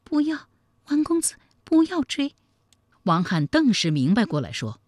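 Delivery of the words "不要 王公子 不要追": high-pitched woman's plea; pitched well up, imploring; pleading. A narrator's reading. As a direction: beg him weakly not to go. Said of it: "哀告道".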